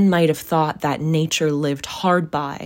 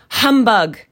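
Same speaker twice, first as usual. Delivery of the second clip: loud, higher scoff; scornful dismissal; 6 semitones higher, 7 dB louder than usual. Acted. Scrooge dismisses the Christmas greeting, loud scornful scoff. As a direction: punch it out with contempt.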